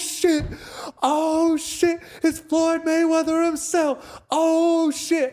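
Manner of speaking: high, loud falsetto whoop; drawn-out high cheer of mock awe; mock hype. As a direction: imitate teammates hailing a champion. Teammates greet him as he enters.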